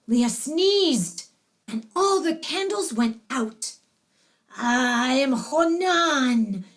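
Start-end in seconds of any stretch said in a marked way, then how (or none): 4.5-6.7 slow, gravelly possessed voice. A narrator's reading. Possessed bride names the spirit inside her, gravelly. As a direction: draw it out with gravel in the throat. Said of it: "said Leah in that gravelly voice".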